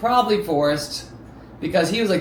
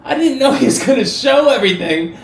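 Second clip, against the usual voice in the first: louder, higher, laughing through it; recalling the shocking video, laughing; 6 semitones higher, 7 dB louder than usual. Real, not acted. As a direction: laugh at how absurd it was. Laughing in disbelief.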